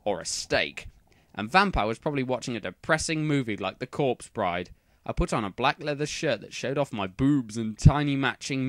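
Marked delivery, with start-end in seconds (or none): none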